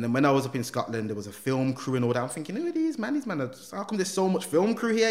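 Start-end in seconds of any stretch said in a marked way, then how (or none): 2.6-5.1 high-pitched baffled reaction; retold disbelief. Storyteller voicing his private thought about the film crew, baffled. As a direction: act out being thrown, pitched well up.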